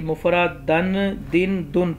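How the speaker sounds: مفردن، دن، دن with endings dan, din, dun, drawn out slowly; three case endings. Shown as a demonstration. Teacher showing students three ways to read it, endings spelt out slowly. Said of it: "تینوں طرح لکھا ہے".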